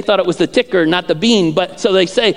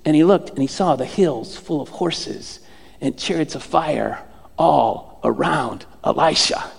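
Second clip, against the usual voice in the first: lower, softer, measured reading; solemn scripture reading; 5 semitones lower, 3 dB quieter than usual. Real, not acted. Preacher reading Elisha's prayer and its answer, solemn scripture reading. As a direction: read the prayer with hushed reverence.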